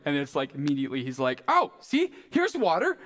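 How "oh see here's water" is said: high-pitched, eager urging, building to a call; acted bursting impatience to get going; fired up. Eunuch urging Philip to baptize him, fired up. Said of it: "so fired up".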